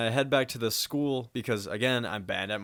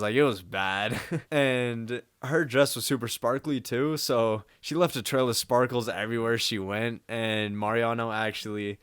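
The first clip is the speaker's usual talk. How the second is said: usual speaking voice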